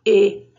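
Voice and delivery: E said closed, like ê, its original sound. Shown as a demonstration. Teacher showing the closed vowel to children, firm. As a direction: give E its plain closed sound. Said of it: "ela fica com o som dela de origem".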